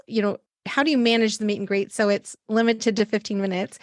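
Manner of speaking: usual speaking voice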